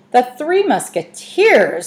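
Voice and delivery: slow, high scornful echo; offended pirate cat scoffing; sniffing disdain. Acted. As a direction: look down your nose at the idea. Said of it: "sniffed Harvey".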